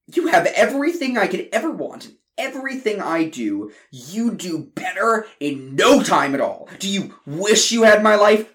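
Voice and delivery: high loud jealous outburst; angry. Acted. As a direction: lash out at your brother. Brothers' quarrel.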